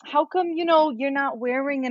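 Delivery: put-on high, prying New York accent; a nosy New York guest's question; New York lady. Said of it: "I'm making her sound New York".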